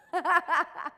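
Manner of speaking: loud, high burst of laughter; hearty laugh. Real, not acted. Coach amused by the player's reply, hearty laugh. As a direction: laugh out loud at your own story. Laughs at own retold punchline.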